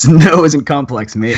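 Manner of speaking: laughing imitation of an Australian. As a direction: tease in a put-on Australian accent. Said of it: "So he's here to defend no".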